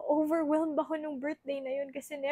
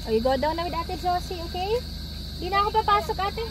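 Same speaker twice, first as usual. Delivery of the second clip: higher, coaxing sing-song; 7 semitones higher, 5 dB louder, faster than usual. Real, not acted. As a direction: mom coaxing her son to go down with the nanny. Coaxing child to go downstairs.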